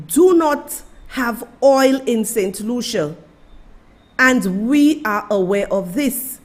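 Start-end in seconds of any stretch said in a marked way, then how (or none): none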